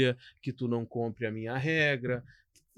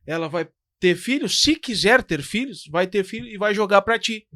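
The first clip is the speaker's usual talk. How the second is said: louder, driving sermon voice to the parents; preaching at full force; 5 semitones higher, 8 dB louder than usual. Real, not acted. Pastor urges the church parents to set an example, preaching hard. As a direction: press every line home like a pulpit appeal.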